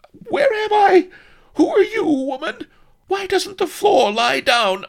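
very high, very loud shrieking. A narrator's reading. Princess shrieking in a drugged daze, very high and loud. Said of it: "and shrieked".

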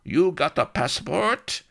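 high, loud, rising demand; truculent Spanish soldier. A narrator's reading. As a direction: demand papers like a hostile guard. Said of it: "he demanded truculently".

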